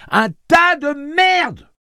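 high, very loud, very slow scream; screamed insult. A narrator's reading. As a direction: spit out each word as loud as you can. Girl repeats insult yelling.

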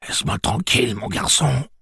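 put-on high startled old man; startled and afraid. Old man frightened by Jake, high startled cry. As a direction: squawk it out in fright. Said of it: "surprise et de la peur".

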